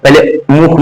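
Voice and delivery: usual speaking voice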